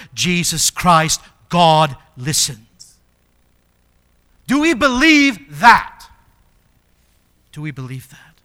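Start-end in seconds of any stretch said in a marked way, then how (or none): 4.5-6.1 high, loud, slow question; forceful challenge. Real, not acted. Challenges congregation, then repeats softly. 7.5-8.4 low, soft searching question; hushed challenge. Real, not acted.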